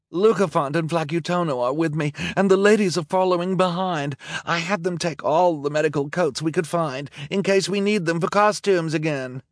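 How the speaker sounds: high, loud female character voice; villainess calling out. A narrator's reading. Villainess reports to her boyfriend through smoke, calling out. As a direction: call news across the distance.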